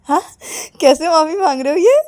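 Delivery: high, loud amused question; amused, laughing. Acted. Mother amused by the odd apology, mock laughing voice. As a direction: ask it as if tickled. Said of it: "हंसते हंसते बोलती".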